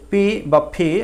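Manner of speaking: পি then ফি, plain P against aspirated PH. Shown as a demonstration. Teacher giving two options for pea, পি or aspirated ফি. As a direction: contrast পি with a breathier ফি. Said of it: "সাধারণত ফ উচ্চারণ করে".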